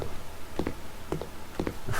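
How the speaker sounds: breathy silent chuckle; unvoiced laugh. Real, not acted. Player finding a maze, breathy laugh. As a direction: laugh under your breath.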